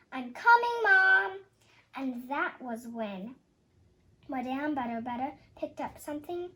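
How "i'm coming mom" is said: higher, louder call back down; shout. A narrator's reading. Mabel shouts back to her mom from the closet, loud. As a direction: yell it toward the stairs. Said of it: "I shouted".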